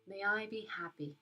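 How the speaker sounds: soft spa whisper. Acted. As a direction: guide them gently into calm. Leads a metta meditation.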